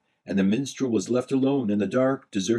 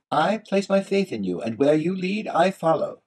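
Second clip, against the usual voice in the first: high-pitched, trusting minstrel; earnest minstrel; 9 semitones higher than usual. A narrator's reading. Minstrel puts faith in the Golux, high earnest voice. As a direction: pledge yourself in a high, earnest voice.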